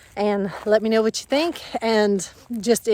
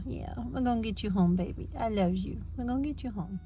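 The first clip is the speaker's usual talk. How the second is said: tender soothing; 2 semitones lower, 7 dB quieter than usual. Real, not acted. Owner to scared dog riding home, tenderly soothing. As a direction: murmur comfort to a scared puppy.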